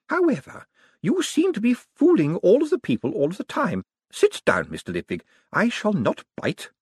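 put-on high old lady voice; wry, teasing command. A narrator's reading. Old lady bidding Moist sit, high and wry. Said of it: "said Missus Lavish".

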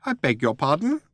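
high little mouse voice, questioning; affronted mouse. A narrator's reading. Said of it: "said Benji".

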